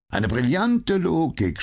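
high mock praise, dripping with irony. A narrator's reading. Holmes mocking Lestrade's logic, high and ironic. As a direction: sneer the compliment. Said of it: "spottete Holmes".

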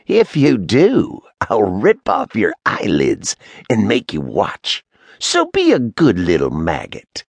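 high cackling witch voice; cackling crone. Acted. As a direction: cackle through it like a cruel hag. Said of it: "Sheeta cackled".

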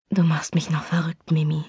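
low, hushed whisper; husky murmur. A narrator's reading. Chris murmuring to Mimi in a husky hushed voice. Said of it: "murmelte er mit heiserer Stimme".

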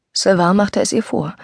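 usual speaking voice

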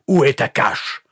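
high, loud, angry demand; vociferating. A narrator's reading. Pastor demanding the captive's hiding place, vociferating. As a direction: bellow the question. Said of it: "vociféra-t-il".

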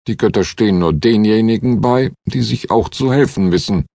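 ordinary delivery

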